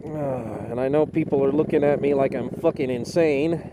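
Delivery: quick, quiet mutter; low, rushed aside. Real, not acted. Aside muttered while riding.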